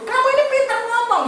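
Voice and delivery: coaxing father. Retold or imitated. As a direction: talk the kid into it with a laugh.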